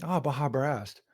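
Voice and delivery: "Ah, Baja Blast" said slowly, vowels stretched; funny drawn-out pronunciation. Real, not acted. Craving Mountain Dew aloud to friends, funny drawn-out pronunciation. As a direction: savor "Baja Blast" with long, soft vowels. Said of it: "Just the way you said that".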